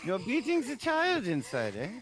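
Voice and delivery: high, loud mock-German shrink; German accent. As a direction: probe like a hammed-up German analyst. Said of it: "Why are you doing a German accent".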